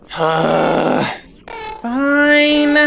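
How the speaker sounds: reluctant surrender; grudging fine. Acted. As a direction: concede through clenched teeth. Gives in again.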